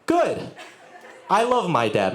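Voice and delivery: ordinary talk, nothing marked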